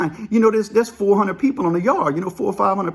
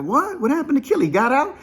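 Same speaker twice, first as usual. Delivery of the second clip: pitched way up, stunned questions; shocked disbelief; 7 semitones higher than usual. Retold or imitated. Friends hearing the spider's gone, shocked disbelief. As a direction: blurt it out in disbelief.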